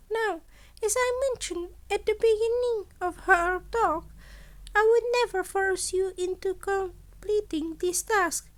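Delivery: chirpy high principal voice, pleased with himself; Nezu, the principal. A narrator's reading. Principal offers a student an extra boon, high and bright. Nezu's voice in the story.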